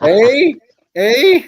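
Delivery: joking at a Canadian friend, mock Canadian. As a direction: ham up the Canadian eh. Teasing the Canadian stereotype.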